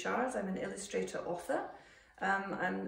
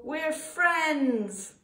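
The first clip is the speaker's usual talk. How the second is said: high, loud, happy finish; joyful declaration; 8 semitones higher, 6 dB louder than usual. A narrator's reading. Happy ending of the story.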